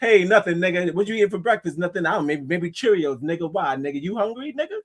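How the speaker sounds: high-pitched mock casual greetings, easing down midway. Mimicking how outsiders think we talk, mock banter. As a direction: act out an exaggerated everyday back-and-forth. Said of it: "they think we just be like".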